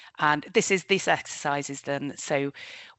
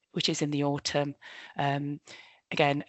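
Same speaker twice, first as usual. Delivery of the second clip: ordinary delivery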